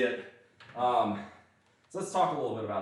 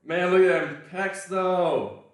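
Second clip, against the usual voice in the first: excited; 8 semitones higher, 6 dB louder, slower than usual. Real, not acted. Admiring his own chest.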